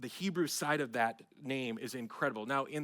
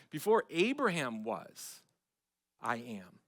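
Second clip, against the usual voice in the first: "Before" stretched and hit hard, slowed; emphatic slow reading of "Before Abraham was"; stress on BEFORE; 6 dB louder, slower than usual. Shown as a demonstration. Rereads verse with new weight.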